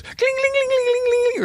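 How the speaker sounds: very high sing-song ringing; imitated bell. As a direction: ring it out like a little bell. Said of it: "grabs the bell and goes".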